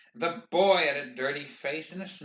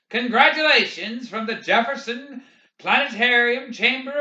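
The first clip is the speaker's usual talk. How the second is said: proclaiming; 8 semitones higher, 7 dB louder than usual. A narrator's reading. Banner proclaims congratulations to the walkers, proclaiming. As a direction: proclaim it like a banner over the crowd.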